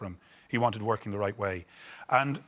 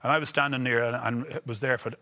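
ordinary talk, nothing marked

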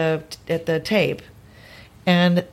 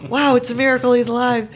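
raised-pitch, exclaimed wonder; mock amazement; 5 semitones higher than usual. Actress imagining blurting out during the take, mock amazed. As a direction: marvel aloud with playful exaggeration. Repeats her imagined improvised line.

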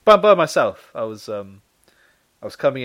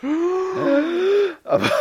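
laughing; 5 dB louder than usual. Real, not acted. Laughing while trying to speak.

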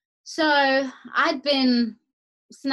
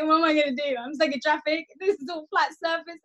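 panicked whining; 6 semitones higher than usual. Retold or imitated. Panicked stuck in traffic.

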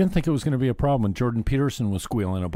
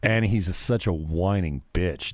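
ordinary delivery

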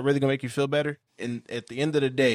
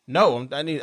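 ordinary talk, nothing marked

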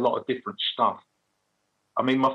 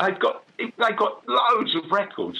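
higher, slow quoted mate; mate's voice; 11 semitones higher, slower than usual. Retold or imitated. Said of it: "And my mate just went".

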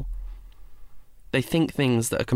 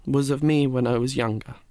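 ordinary delivery, nothing marked

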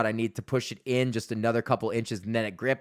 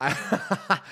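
ordinary talk, nothing marked

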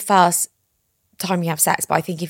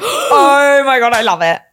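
real excitement; 6 semitones higher, 9 dB louder than usual. Real, not acted. Delighted reaction to segment intro.

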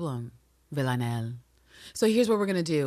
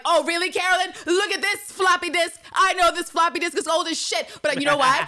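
much higher, louder taunting voice; smug bluff; 11 semitones higher, 4 dB louder than usual. Pretend. Host pretending to bluff Carolyn with a floppy disk. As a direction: sell the lie with swagger. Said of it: "I'd have been like".

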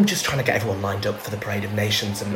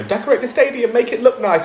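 higher, louder mock instructions; pitched-up quote of a demand; lightly voiced bosses; 13 semitones higher, 5 dB louder than usual. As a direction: parrot the bosses in a raised voice. Retold instructions from the organisers.

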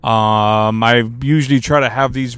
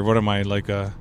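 normal talking voice

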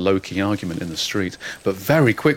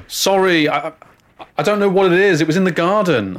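flustered; 7 semitones higher, 4 dB louder than usual. Acted. Flustered apology to panicking parents.

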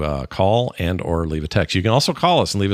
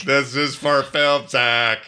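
slow, higher echo of a voicemail; imitating the caller; 5 semitones higher, much slower than usual. Host echoing a voicemail caller, slow imitation. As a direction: drag it out like the caller.

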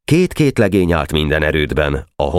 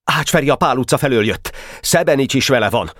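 high, excited lookout's report; urgent high call that gradually settles; exclaimed sighting; 8 semitones higher than usual. A narrator's reading. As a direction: cry out what you spot, pitch raised. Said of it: "Felkiáltott".

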